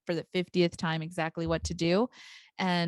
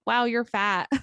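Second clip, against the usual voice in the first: put-on blunt kid; 4 semitones higher, 4 dB louder than usual. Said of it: "they tend to say things like".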